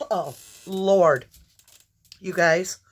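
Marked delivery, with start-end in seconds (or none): 0.1-1.3 coughing fit. Real, not acted.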